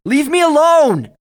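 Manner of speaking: long, high, full-volume scream. A narrator's reading. Alex yells at something behind the boxes, screaming. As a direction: scream it out, stretching the words. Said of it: "and then said louder".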